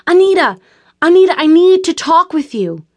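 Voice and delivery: high, loud frantic shouts; urgent yelling through a door; shouting. A narrator's reading. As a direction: bang on the door and yell for her.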